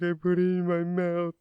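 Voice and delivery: put-on high, loud, sobbing small-kid voice; acted kid crying fit, words half understood; crying little kid. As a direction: blubber it out like a panicked little boy. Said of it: "best reenactment I could do of a little kid fucking crying".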